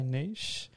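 niche said as "neesh"; long ee and sh in niche; pronunciation heard from Americans. Retold or imitated. Reporting other Americans who say it, niche as "neesh". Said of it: "I have heard other Americans say".

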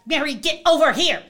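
high, loud repeated calling; mock yell. Woman hollering at her loose dog. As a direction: holler the name over and over. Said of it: "Blankety blank".

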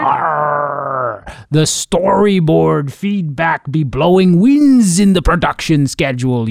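slow, loud pirate growl; hammed-up pirate captain. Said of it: "That's my pirate voice".

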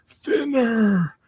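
high, eerie rasp; whispered horror-movie curse; creepy curse. Pretend. Creepy Stephen King curse to diners.

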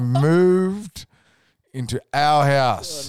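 moved into our house punched high and loud, slowly; emphatic repeat. Real, not acted. Telling mate the shocking news, slow loud emphasis. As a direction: lean on every word, slow and loud.

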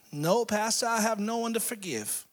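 put-on high-pitched old lady voice; little old lady. Old lady answering her pastor, high little voice. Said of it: "little old ninety-three-year-old lady".

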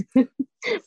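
a real high laugh at the idea; short laugh that rises at the end; amused laugh. Real, not acted. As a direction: laugh at kicking someone out. Laughs about kicking someone out.

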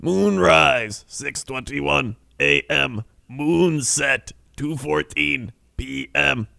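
measured announcer forecast read; weather forecast read. Acted.

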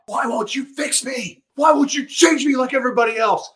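high, strained cry of fury at God; desperate angry plea to heaven; cursing at God. Retold or imitated. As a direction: rail at God, pleading and furious. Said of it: "I started cursing at God".

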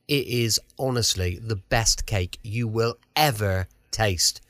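ordinary talk, nothing marked

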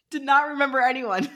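laughing through the words. Real, not acted. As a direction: giggle while admitting it. Laughing confession.

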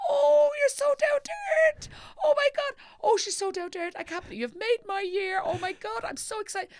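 mimicking a breathless overexcited fan; starstruck fan squeal. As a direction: gush like a fan who can't believe her luck. Starstruck fan meets celebrity.

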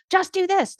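mock yell at a kid. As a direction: bark it like an impatient parent. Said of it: "just yell at your kid to say".

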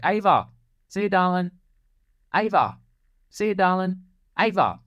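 put-on high, sing-song goodbye to a toddler; calling out sweetly. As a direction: call to the little girl in a baby-talk voice.

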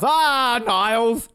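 high, drawn-out sitcom exclamation; Frasier impression. Mock Frasier exclaiming to his brother in the sitcom, Frasier impression. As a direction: wail it out like Frasier. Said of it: "I think that was a Frasier episode".

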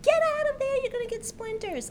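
high, sharp scolding call; mother yelling. Retold or imitated. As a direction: yell like a worried mom. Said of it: "my mother would yell at me".

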